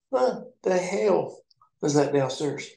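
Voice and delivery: high, slow, stretched-out disbelief; shocked question. Retold or imitated. Asking Ed about the new cook downstairs, stunned. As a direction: gasp it out like you can't believe your eyes.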